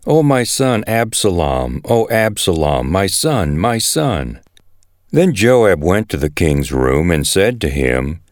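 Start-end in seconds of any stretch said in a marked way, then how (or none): none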